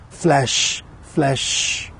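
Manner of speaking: flesh, flesh, the last nearly breathed; fading echo. Acted. Poet echoing a word in his love poem, fading to a breath. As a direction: let flesh die into a breath.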